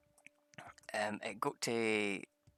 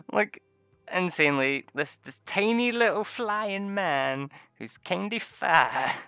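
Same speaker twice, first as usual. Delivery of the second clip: much higher, louder, slow excited telling; excited gushing; 8 semitones higher, 7 dB louder, slower than usual. Real, not acted. Player raving to viewers about the fighter, excited. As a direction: gush over the tiny flying man.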